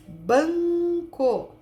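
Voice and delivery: BANCO drawn out on a long, high nasal first syllable; som nasal demonstrado. Shown as a demonstration. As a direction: hold the nasal in "banco", then place "co". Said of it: "N aqui". ("banco" com o som nasal bem esticado)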